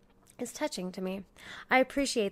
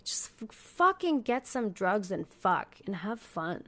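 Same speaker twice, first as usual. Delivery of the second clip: ordinary talk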